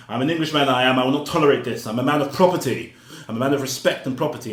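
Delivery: loud, high, haughty Englishman; mock posh Englishman declaring his property; pompous English soldier. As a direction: ham up the proud English gent. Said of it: "this English guy comes along talking about".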